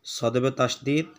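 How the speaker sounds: ordinary talk, nothing marked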